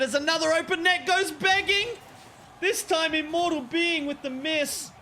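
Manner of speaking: high, urgent live commentary; excited miss call. Real, not acted. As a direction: exclaim over the blown chance. Another missed open net.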